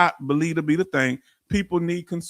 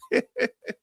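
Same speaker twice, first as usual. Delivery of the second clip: laugh; 3 dB quieter than usual. Real, not acted. Laughs about Doc Shelley fussing.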